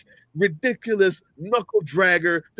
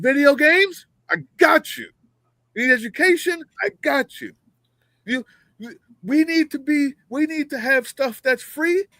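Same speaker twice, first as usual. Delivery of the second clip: loud, high hype pitch to a buddy; rally the stay-at-home voter like a bro; hyped-up shout to everybody who stayed home; shouting from the rooftops; 5 semitones higher, 3 dB louder than usual. Retold or imitated. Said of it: "I would be shouting from the rooftops".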